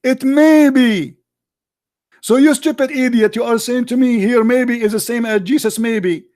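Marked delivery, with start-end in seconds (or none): 0.0-1.2 "may be" drawn out slowly; slow mocking emphasis. Real, not acted. Mocking the quoted phrase.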